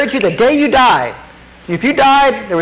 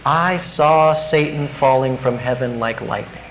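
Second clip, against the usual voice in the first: softer, lower, understated; Jesus speaking gently; 8 semitones lower, 5 dB quieter than usual. Retold or imitated. Jesus answering a returning disciple, softer and lower. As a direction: say it quietly, as if Jesus were telling you personally. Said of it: "wouldn't you love to hear Jesus say that?".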